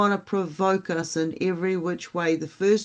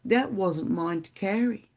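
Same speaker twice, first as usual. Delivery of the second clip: ordinary delivery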